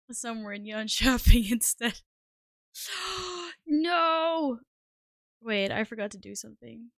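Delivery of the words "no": shocked gasp and no. Real, not acted. Realizes she forgot something.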